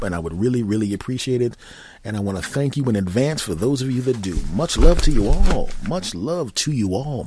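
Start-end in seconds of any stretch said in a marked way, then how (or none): 4.5-5.7 louder, higher burst of affection; hearty sign-off. Real, not acted. Sign-off thanks repeated with rising fervour. 5.9-7.2 slow, higher, swooping affection; drawn-out sign-off. Real, not acted. Same sign-off drawn out, softer.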